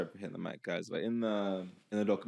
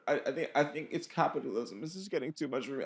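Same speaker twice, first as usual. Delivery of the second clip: ordinary delivery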